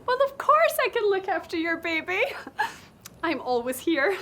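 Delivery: usual speaking voice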